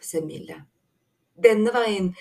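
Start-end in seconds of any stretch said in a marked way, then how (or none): 1.3-2.1 raised, louder call. A narrator's reading. Håp points the others the way, calling out. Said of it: "ropte Håp".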